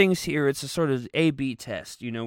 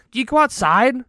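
loud mock rant; 9 semitones higher, 6 dB louder than usual. Heated mock rant at people.

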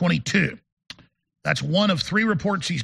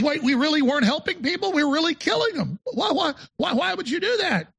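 much higher, rising disbelief, mock naive voice; shocked liberal; 8 semitones higher than usual. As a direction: play the dawning horror of a clueless bureaucrat, pitch climbing. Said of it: "And he goes".

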